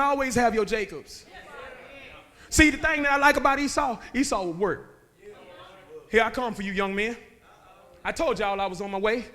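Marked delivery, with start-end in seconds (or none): none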